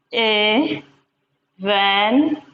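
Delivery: "Evangelism" drawn out very slowly; very slow "Evangelism" for the class; slow syllable split. Shown as a demonstration. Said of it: "This has five syllables".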